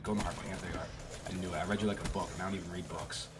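ordinary talk, nothing marked

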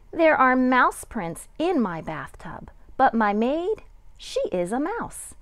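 lively picture book read-aloud with a sing-song lilt; reading to children. A narrator's reading. Kindergarten teacher reading a picture book to her class, lively. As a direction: read it like story time for kindergarteners.

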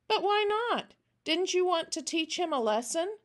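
put-on high-pitched child voice, protesting; small girl wanting to know why; puzzled little girl. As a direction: press the question like a baffled child. Child puzzled, questioning old man.

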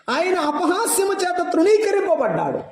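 high, ringing preacher's cry; preaching at full force. Acted. A preacher lifting his voice to the congregation. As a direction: proclaim it to the whole hall.

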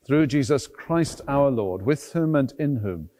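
ordinary delivery

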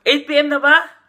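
surprised. Real, not acted. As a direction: react as if the time just hit you. Reacting to viewer's message.

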